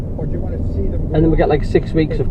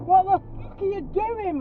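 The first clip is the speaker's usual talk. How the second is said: furious. Real, not acted. Road rage at a driver.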